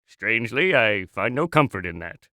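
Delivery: very low, resigned aside; glum deadpan. Acted. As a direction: sink low and say it drily.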